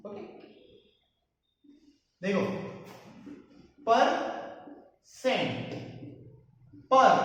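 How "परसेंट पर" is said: "Percent" broken into "पर" and "Saint" with a long pause; word split in parts. Shown as a demonstration. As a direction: say "percent" slowly, syllable by syllable, then "पर" alone. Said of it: "पर मतलब upon".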